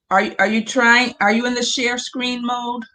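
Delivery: normal talking voice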